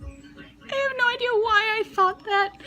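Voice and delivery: words squeezed out through giggles; laughing till crying. Real, not acted. Daughter confessing her blunder, laughing till tears. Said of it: "'cause I'm crying so hard".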